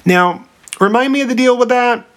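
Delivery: very high-pitched, airy-headed query; clueless asking. Acted. Oedipus asks the Sphinx about the deal, clueless high voice. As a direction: sing it out high, as if only vaguely remembering.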